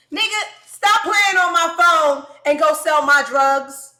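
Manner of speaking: loud, slow, furious shout at the caller; angry yell. Retold or imitated. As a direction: yell it right down the phone, slowly. Yelling at prank caller.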